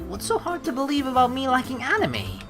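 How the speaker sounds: high-pitched mock imitation of her; mimicking the character. Player teases Kaori with her own line, high mocking imitation. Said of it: "you said the same thing".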